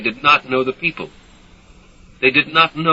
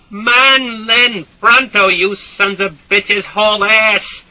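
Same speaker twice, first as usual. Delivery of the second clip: furious shouting; 10 semitones higher, 5 dB louder, much slower than usual. A narrator's reading. Enraged soldier.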